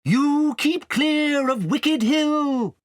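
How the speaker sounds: high, loud, slow warning; owls' warning cry. A narrator's reading. Owls crying a warning to the riders, high and slow. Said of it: "the owls cried".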